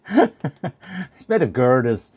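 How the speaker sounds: put-on a slow stab at a Scottish accent that drifts Irish; drawn-out mock Scottish accent; attempted Scottish accent. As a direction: quote the Irn-Bru ad in a shaky Scottish accent. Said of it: "even though it's not a Scottish accent".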